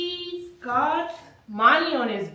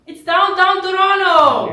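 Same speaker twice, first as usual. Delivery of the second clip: high, loud announcement; loud, high-pitched hurrah; excited reveal; 7 semitones higher, 9 dB louder than usual. Real, not acted. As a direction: shout it like a big reveal. Grand reveal of the board.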